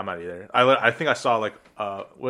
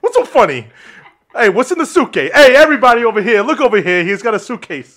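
loudmouth rustic guy, lightly played; 4 semitones higher, 6 dB louder than usual. Rustic guy exposing the suitcase to passengers, mock outrage. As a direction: call the crowd over with a loud burst. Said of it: "I'm gonna be the, uh, rustic guy".

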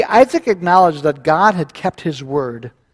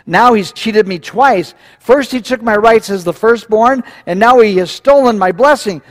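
exclaimed grievance, higher and louder; Esau; 6 semitones higher, 5 dB louder than usual. A narrator's reading. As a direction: cry out the complaint, voice raised. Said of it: "Esau exclaimed".

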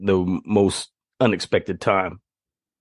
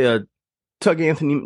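ordinary talk

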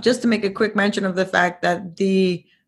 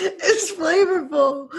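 high, drawn-out words through laughter; laughing; 11 semitones higher, slower than usual. Real, not acted. Co-host joining the sofrito joke, laughing hard. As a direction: crack up while saying it.

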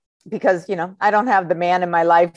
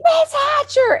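very high squeal of a greeting; high-pitched mock kid scream; excited kids screaming; slower than usual. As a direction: imitate children shrieking for their teacher. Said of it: "they're all screaming".

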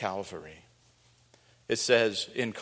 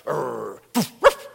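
imitated dog bark; 7 dB louder, slower than usual. The dog barking at someone in a hat, growl and yap. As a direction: bark like a jumpy little dog.

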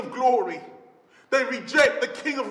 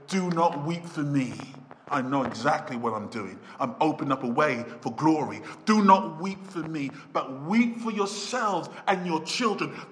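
low, calm and steady; calm tender Jesus; 8 semitones lower than usual. Acted. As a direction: reassure them gently. Preacher voices Jesus calmly.

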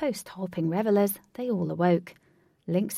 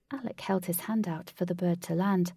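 ordinary delivery, nothing marked

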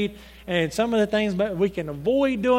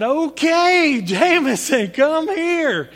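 loud, high retold summons; calling out; 6 semitones higher, 6 dB louder, slower than usual. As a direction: call a child over from another room. Father calls son over, retold.

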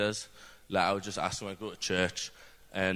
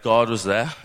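louder, rising declaration; emphatic; 3 semitones higher, 10 dB louder than usual. Real, not acted. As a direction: proclaim it with conviction.

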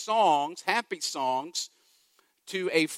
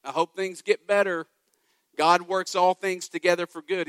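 mock cheerful platitudes; 3 semitones higher, 6 dB louder than usual. Mocking churchgoers who throw Bible verses at hurting people, hammed-up cheerful. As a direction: toss off bright church clichés at a hurting friend. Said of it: "Saying things like".

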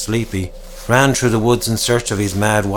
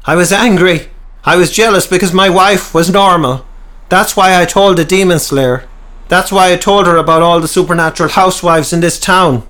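louder, higher pained outburst; heated confession; 8 semitones higher, 6 dB louder than usual. A narrator's reading. Peter confessing to the townsmen, loud and pained. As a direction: blurt it out in a raised voice.

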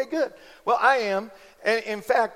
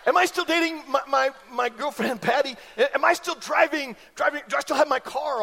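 laughing, pitched-up confused asking; confused questions; 5 semitones higher than usual. Retold or imitated. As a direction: play the dazed teen. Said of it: "I said".

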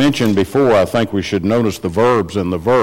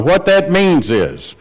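normal talking voice

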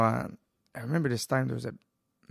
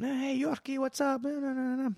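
imitating a regular; 13 semitones higher, 3 dB quieter than usual. Regulars greeting him at door.

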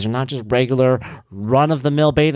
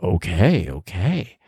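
mock weary okay; 5 semitones lower, much slower than usual. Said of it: "I always get that big follow-up".

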